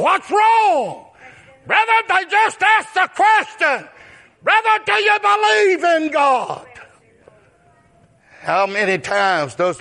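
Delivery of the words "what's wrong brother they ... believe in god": preaching at full force. Acted. Preacher shouting back at critics of the yard signs. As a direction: throw the question at them.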